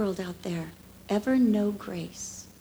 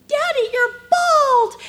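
put-on little girl; 9 dB louder, slower than usual. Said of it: "the one little girl's like".

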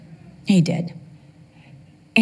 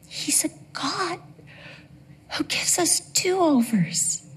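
tearful; 6 dB quieter, slower than usual. Real, not acted. Emotional sermon point.

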